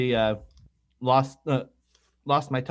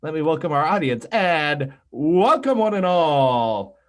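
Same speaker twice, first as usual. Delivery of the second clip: big drawn-out show opening; show announcer; 3 semitones higher, 4 dB louder, slower than usual. Acted. Host welcoming audience on YouTube and in podcast land, grand announcer. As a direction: swoop up and stretch the words like a ringmaster.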